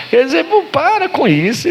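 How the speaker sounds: high-pitched, incredulous "come on"; exasperated, jumping high in pitch; exasperated protest. Real, not acted. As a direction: tell them to cut it out, voice leaping up. Scolding the superstition he mocked.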